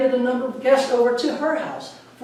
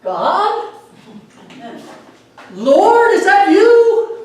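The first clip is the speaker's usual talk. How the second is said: high, loud, slow trembling plea upward; hammed-up nervous call to God, much higher; unnerved deacon; 10 semitones higher, 8 dB louder, much slower than usual. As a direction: ask the sky, scared and unsure. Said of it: "really unnerved".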